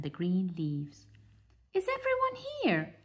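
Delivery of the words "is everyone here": high, quick mother duck voice; high fussy mother bird. Acted. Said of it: "asked Mother Duck".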